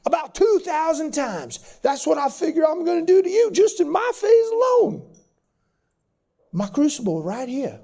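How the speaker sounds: Southern instructor drawing recruits in, building to forceful punches; deep Southern accent. Retold or imitated. Old instructor tells seal recruits the price, in a Southern accent. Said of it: "deep Southern accent".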